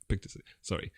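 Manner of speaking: ordinary delivery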